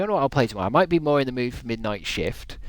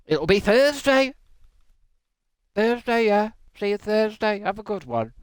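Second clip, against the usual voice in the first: cheery sign-off; 8 semitones higher than usual. Real, not acted.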